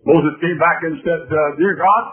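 high, loud gospel storytelling; preaching. Acted. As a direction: tell the scripture at full force.

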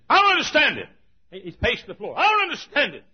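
loud, high exasperated quote; retelling Ken Chandler's baffled anger; mad. Retold or imitated. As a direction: play the frustrated staff man. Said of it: "he was mad".

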